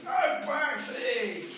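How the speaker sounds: high, very slow sung cheer; mock cheer. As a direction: stretch it out like the hill girls. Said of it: "I started going".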